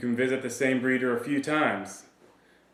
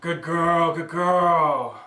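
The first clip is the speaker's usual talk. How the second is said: loud, higher, drawn-out praise; excited puppy praise; 3 semitones higher, 5 dB louder, much slower than usual. Shown as a demonstration. Trainer praises a puppy going for the chew toy, excited praise. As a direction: gush proudly at the puppy, stretching the words.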